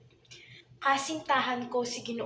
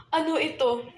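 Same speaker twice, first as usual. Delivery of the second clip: raised, anguished disbelief; shocked grief; 3 semitones higher, 4 dB louder than usual. Acted. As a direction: cry out at terrible news, theatrically. Learns her beloved is dead. (madulang pagdadalamhati at pagkagulat)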